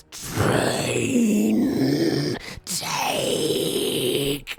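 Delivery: long drawn-out eerie voice; rippling voice. Acted. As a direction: stretch each word out like a blade whispering hungrily. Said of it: "you can hear this rippling voice in your ear go".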